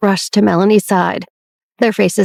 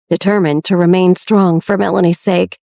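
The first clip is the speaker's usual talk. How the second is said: plain reading voice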